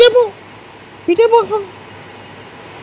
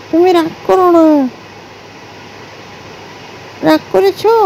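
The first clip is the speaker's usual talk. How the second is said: lower, gentle coaxing after a scolding; tender, lower-pitched making up; soothing; 3 semitones lower, 3 dB quieter than usual. Real, not acted. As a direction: soothe an upset pet, drawing words out.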